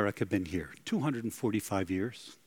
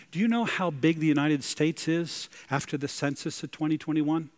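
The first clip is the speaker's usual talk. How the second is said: normal talking voice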